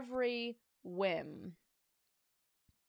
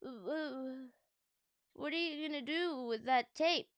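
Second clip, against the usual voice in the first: timid stammer, a touch higher; 3 semitones higher than usual. Acted. George asks the principal nervously, timid stammer. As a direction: stutter out the question, scared. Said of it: "he said".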